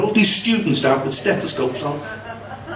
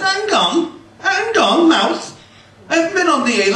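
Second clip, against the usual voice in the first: husband's voice pitched way up, pleading; high defensive husband; 11 semitones higher than usual. Retold or imitated. Husband fobbing off his wife, high and flustered. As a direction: squeak out a flustered excuse.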